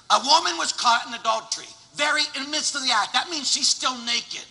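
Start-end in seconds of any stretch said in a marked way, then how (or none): none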